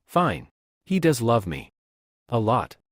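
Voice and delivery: usual speaking voice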